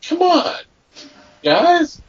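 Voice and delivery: high-pitched, exasperated appeal; exasperated disbelief. Acted. Host scolding pranksters over weak April Fools' jokes, exasperated disbelief. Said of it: "some of the stuff I saw is like".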